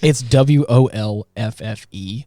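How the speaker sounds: W O L F F E spelled slowly, each letter separate. Shown as a demonstration. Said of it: "how you spell wolf".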